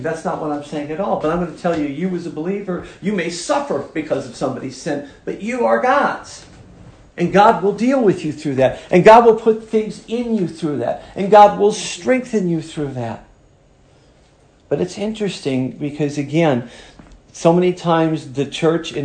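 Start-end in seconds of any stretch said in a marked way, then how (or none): 5.2-13.2 raised, building preacher's voice; fiery preaching. Acted. Preacher assuring believers, building to full force. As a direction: drive each line harder.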